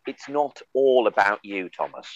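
slow, measured reproach, dropping away on the name; drawn-out telling-off like a wronged mother; scolding mother. Retold or imitated. Partner dumping him by full name, slow motherly reproach. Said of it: "like a mother holding shards of a vase".

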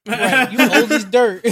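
laughing through the words, slowly. Real, not acted. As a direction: crack up while teasing. Laughing joke.

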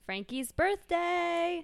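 high, slow and drawn out; slow drawn out greeting. Acted. Heidi greets Lauren at Frankie's party, slow and sweet. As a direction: stretch it sweetly.

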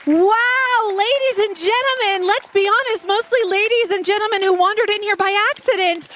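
game show host. Acted. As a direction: announce it like a grand ceremony host. Mock grand announcement.

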